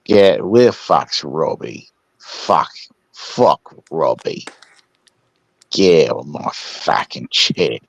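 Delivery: put-on low, slow drunken Aussie cursing; drunk Captain Kangaroo. Asked by S1, drunk Aussie Captain Kangaroo, low slow slurring. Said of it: "Do a, do a drunk Captain Kangaroo".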